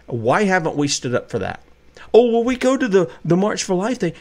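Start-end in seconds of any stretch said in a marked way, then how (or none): 2.1-4.1 mocking excuse. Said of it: "mocking pro-life talkers".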